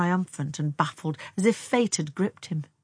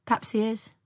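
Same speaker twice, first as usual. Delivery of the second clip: high, cool concession; cold; 6 semitones higher than usual. A narrator's reading. Older sister answering praise of Tom, coldly. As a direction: concede it coolly without warmth. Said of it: "said Frances coldly".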